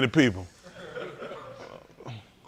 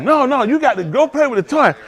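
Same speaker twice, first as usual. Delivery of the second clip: mock firm parent; 8 semitones higher, 3 dB louder than usual. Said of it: "You're like".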